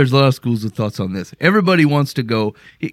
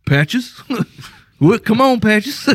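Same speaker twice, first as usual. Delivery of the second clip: mock pet-calling; 6 semitones higher, slower than usual. Host mimics someone seeking a pet in heaven, mock calling. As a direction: playfully call a pet, questioning lift. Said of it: "and going".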